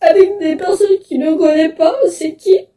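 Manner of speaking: tearful, voice wavering between sobs; crying. Real, not acted. Girl telling viewers about racism at school, crying. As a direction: speak through real tears.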